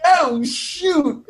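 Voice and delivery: high-pitched startled reaction; playful high yelp of alarm; mock alarm. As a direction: imitate being spooked, voice jumping up. Acting scared reaction to yelling lieutenant.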